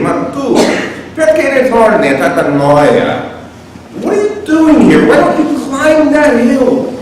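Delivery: high-pitched, hammed-up Italian American questioning; Italian American accent. Virgil asking Dante why he turns back, Italian American accent. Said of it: "an Italian American accent".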